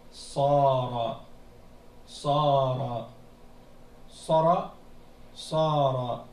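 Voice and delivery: "Sara" repeated, long first a, said clearly for students; "Sara", drawn-out a, repeated as a model; slow demonstration. Shown as a demonstration. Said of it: "ici ça fait".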